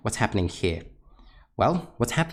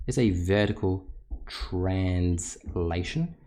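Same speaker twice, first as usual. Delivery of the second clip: slow emphatic repeat; much slower than usual. Shown as a demonstration. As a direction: drag the term out for students to note. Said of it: "teacher repeats key term".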